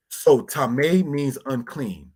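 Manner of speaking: normal talking voice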